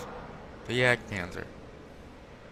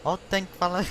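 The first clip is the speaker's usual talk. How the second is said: mock Russian accent; 7 semitones higher than usual. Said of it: "I'm not gonna do the Russian accent".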